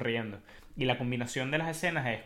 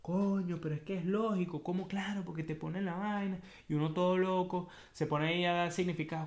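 higher, excited inner voice; amazed realization; 6 semitones higher than usual. Retold or imitated. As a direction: suddenly get it, amazed. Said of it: "yo estoy leyendo acerca de esto y yo".

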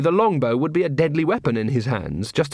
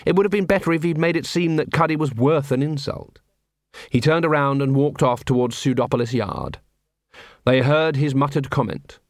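ordinary delivery, nothing marked

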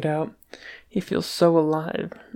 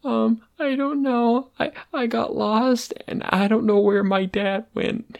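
confused; 7 semitones higher, 3 dB louder than usual. A narrator's reading. Lost boy answers the yelling lady, high and confused. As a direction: stammer it like a lost child, pitched up.

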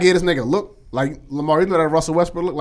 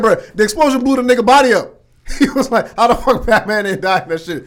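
higher and louder, worked-up retelling of the cousin; excited retelling; 7 semitones higher, 7 dB louder than usual. Retold or imitated. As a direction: tell it like the cousin can't believe it. Cousin's outrage retold loudly.

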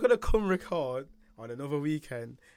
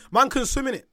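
raised, insistent protest; louder, higher outrage; indignant shout; 7 semitones higher, 6 dB louder than usual. Real, not acted. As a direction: push back loudly, offended.

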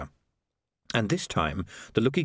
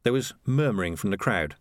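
usual speaking voice

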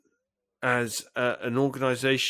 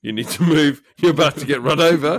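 louder, insistent driver's warning; mock urgent warning; 6 dB louder than usual. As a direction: press the pigeon to get out of the way. Acted driver warning a pigeon.

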